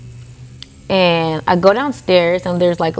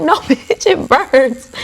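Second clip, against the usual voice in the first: laughing retort; 8 semitones higher, much slower than usual. Retold or imitated. Talking back to paramedic.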